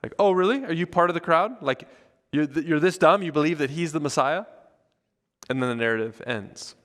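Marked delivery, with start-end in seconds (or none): 0.2-4.5 louder, higher, scornful mock questioning; turning on someone. Pharisees turn on Nicodemus, scornful challenge. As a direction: round on him in disbelief. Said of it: "they turn on Nicodemus".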